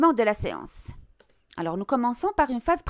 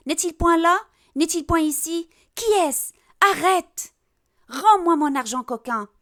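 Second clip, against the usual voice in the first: frantic, high-pitched cries for help; Harpagon crying out; 5 semitones higher, 4 dB louder than usual. Acted. Robbed miser hunting the thief alone on stage, crying out. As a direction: cry out in panic like a robbed miser. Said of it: "il a commencé à crier".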